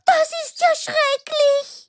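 high-pitched shocked dismay; horrified. Acted. Hearing of raccoons bred for fur, horrified. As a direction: gasp out your horror, high.

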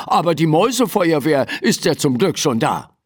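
ordinary delivery, nothing marked

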